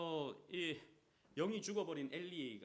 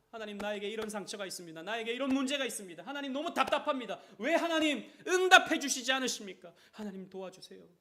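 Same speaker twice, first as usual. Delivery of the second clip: loud, high crying out to God; anguished prayer; 7 semitones higher, 11 dB louder than usual. Acted. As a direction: pour out the pain, pleading hard.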